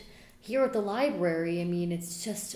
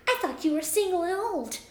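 fairy voice; 6 semitones higher than usual. Acted. Fairy Trisha teasing.

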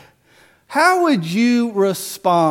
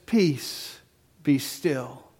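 softer, lower, quiet authority; calm command; 5 semitones lower, 9 dB quieter, much slower than usual. Retold or imitated. Jesus speaking to the wind and waves, soft and low. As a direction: hush the storm gently.

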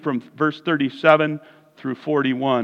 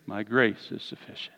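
soft, low, calm reply; quiet assurance; 7 semitones lower, 11 dB quieter than usual. Retold or imitated. The Lord answering Paul, soft and low. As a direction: speak gently and with certainty. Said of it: "But the Lord replied".

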